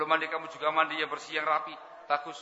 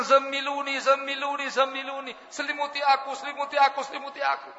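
frightened plea; 10 semitones higher than usual. Acted. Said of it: "ketakutan, panik".

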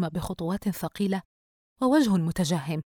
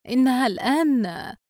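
slow, higher, hesitant answer; 6 semitones higher, 4 dB louder than usual. A narrator's reading. Mother hesitating over her daughter's age, hesitant. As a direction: draw the words out, unsure. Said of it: "الأم بتردد".

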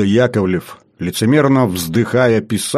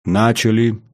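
ordinary delivery

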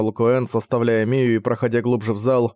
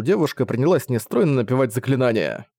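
plain reading voice

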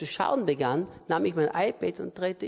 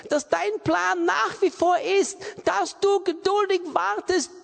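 high, forceful prayer of commitment; impassioned preaching; 10 semitones higher, 4 dB louder than usual. Real, not acted. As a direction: build a fervent declaration to God, pitch raised.

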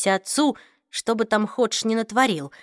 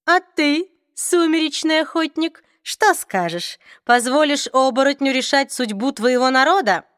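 cold vampire voice; 5 semitones higher, 3 dB louder than usual. Acted. Raphael addresses Jocelyn.

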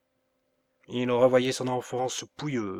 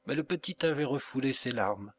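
ordinary delivery, nothing marked

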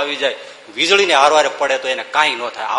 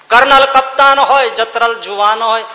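declaimed verse; 5 semitones higher, 6 dB louder than usual. Acted. Repeats verse refrain, declaiming.

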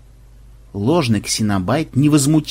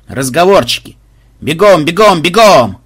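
loud, raised drill command; barked order; 3 semitones higher, 6 dB louder than usual. A narrator's reading. Banzai hurrying his squad along, barking orders. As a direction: chivvy the squad with a shout. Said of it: "прикрикнул Банзай".

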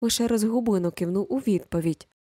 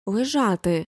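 firm, slightly raised order; command to a dog; slower than usual. A narrator's reading. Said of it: "гукнув до пса". (твердий наказ псові)

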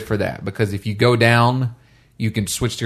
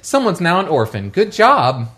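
lightly voiced mocking praise; a hint of a dry disapproving mother; sarcastic mom voice; 6 semitones higher than usual. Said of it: "like a mom type character".

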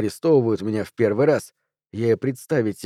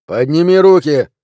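loud, higher barked order; police command; 4 semitones higher, 8 dB louder than usual. A narrator's reading. Policeman ordering hands up, loud and high. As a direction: bark the order at a suspect.

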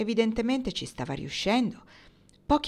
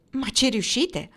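sharp, raised interruption; mild angry interruption, a bit louder; almost angry; 5 semitones higher, 4 dB louder, slower than usual. A narrator's reading. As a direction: cut her off, nearly angry. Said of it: "quasi con rabbia".

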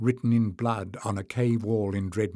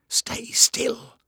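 sharp urgent whisper, much higher; hissed whisper; 12 semitones higher, 3 dB louder than usual. A narrator's reading. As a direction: hiss it to keep him down. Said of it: "Jasmine hissed".

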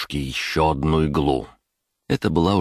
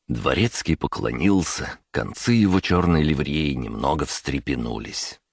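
ordinary delivery

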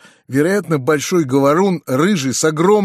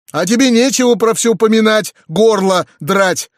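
high, louder rebuke; reproachful scolding; 8 semitones higher, 5 dB louder than usual. A narrator's reading. Uncle rebukes the talkative peasant, reproachful. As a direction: scold him with a raised voice. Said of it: "с укоризной обращаясь к мужику".

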